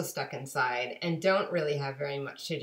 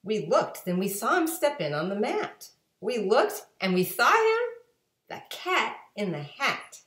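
higher, louder, building excitement; excited narration; 6 semitones higher, 5 dB louder than usual. A narrator's reading. Telling kids the cat shows up, excited. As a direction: announce the cat's arrival with wonder.

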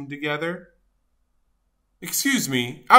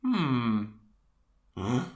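plain reading voice

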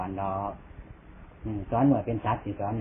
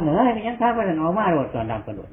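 shouting out; 6 semitones higher, 4 dB louder than usual. Retold or imitated. Mock shout at a neighbour.

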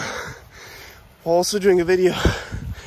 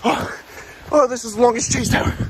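ordinary delivery

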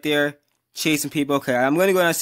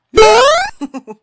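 loud startled laugh; 14 semitones higher, 11 dB louder than usual. Real, not acted. Scared by Piggy, bursts laughing.

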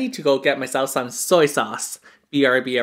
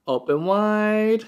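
normal talking voice

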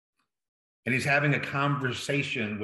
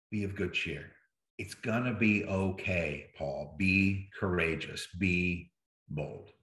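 softer and lower throughout, a calm comforting voice; gentle reassurance; 6 semitones lower, 4 dB quieter than usual. Acted. Jesus in the jail cell reassuring Paul, soft and low. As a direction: lean in and quietly steady a frightened friend.

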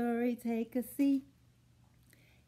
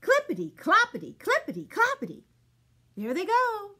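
fast, higher and louder rhythm; galloping excitement; 8 semitones higher, 6 dB louder, faster than usual. A narrator's reading. Narrator races the horse along, fast and excited. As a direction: rattle it off like a gallop.

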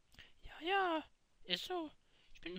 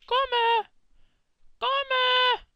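calling out; 5 semitones higher, 11 dB louder than usual. Acted. Calling Gomme into the room.

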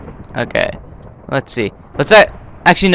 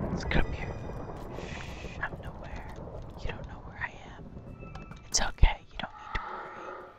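playful hushed whisper; 20 dB quieter, faster than usual. Pretend. Gamer whispers while hiding from players, hushed whisper. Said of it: "hear me whisper".

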